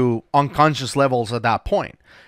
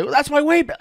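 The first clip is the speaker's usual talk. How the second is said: much higher, lightly put-on voice of a diver; a quick imitation of a diver, pitched up; mock diver; 14 semitones higher than usual. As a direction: diver pointing out his own weight belt, mock higher voice. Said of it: "They threw it in and say".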